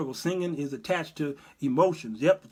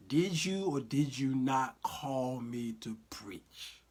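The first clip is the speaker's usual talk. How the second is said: slow solemn; 4 semitones lower, 7 dB quieter, much slower than usual. Pretend.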